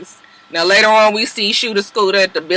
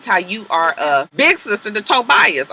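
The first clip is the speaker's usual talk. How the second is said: ordinary talk, nothing marked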